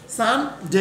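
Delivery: "সানডে" in two clear parts, "san... dey"; model pronunciation. Shown as a demonstration. Teacher shows student how to say it, "সানডে" as "san... dey". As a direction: say "সানডে" carefully, with an "ey" glide at the end. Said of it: "বলার সময় এরকম বলবে".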